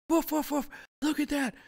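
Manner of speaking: high, loud yappy dog voice; barking little dog. Acted. Little dog barking at you, high yapping voice. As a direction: bark it out like an excited little dog. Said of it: "little dogs are barking at you".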